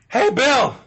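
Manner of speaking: high-pitched mock robot call. Robot alerting guard to trouble at the mall, high robot voice. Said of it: "robot could be just going around going".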